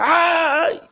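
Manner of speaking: a sharp ouch as the leg gives; pained cry. Retold or imitated. Preacher voicing Jacob as his leg is struck, high pained cry. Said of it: "ele grita".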